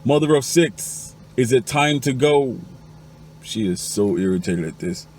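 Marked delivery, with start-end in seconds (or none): 0.1-2.6 loud, high, drawn-out holler; high-pitched public holler, stretched out; top-of-voice yell. Acted. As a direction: yell it out across a crowded party. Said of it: "at the top of his voice".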